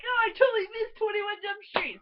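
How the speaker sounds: high, loud gushing exclamation; exclaimed longing. Retold or imitated. Fan wanting to rewatch the first movie, exclaimed longing. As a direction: wail it with playful enthusiasm. Acts out sudden fond longing.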